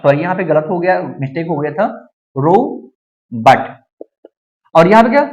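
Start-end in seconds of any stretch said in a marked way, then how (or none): 2.4-3.7 रॉबर्ट split slowly, "rou... bat"; low, drawn-out रॉबर्ट; British O. Shown as a demonstration. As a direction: model रॉबर्ट one syllable at a time. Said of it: "British में ओ को".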